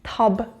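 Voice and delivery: "table" read as tab-leh, both vowels sounded; Armenian-style reading. Shown as a demonstration. Teacher reading English table as Armenian, tab-leh. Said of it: "If this was a word in Armenian".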